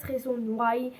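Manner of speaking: English title in a heavy French accent; poor English accent. Real, not acted. Vlogger naming her favourite series, poor French-accented English. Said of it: "mon accent anglais est très nul".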